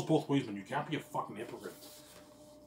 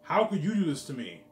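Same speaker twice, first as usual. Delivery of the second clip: higher, louder, hammered again; loud mock repeat; 6 semitones higher, 9 dB louder than usual. Repeating his girlfriend's complaint to viewers, loud and mocking. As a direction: throw her words back, louder.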